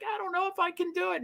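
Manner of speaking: high, soft and fast, imitating a worried mod; imitating a nervous mod's quick, hushed doubt. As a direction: squeak it out quickly, unsure of herself. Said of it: "she was like".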